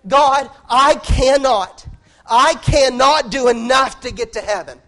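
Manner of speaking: high, loud, slow desperate cry to God; a sinner loudly pleading with God; anguished outcry. Acted. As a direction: cry out to heaven as if you can't bear it. Voiced prayer, quiet then cried out.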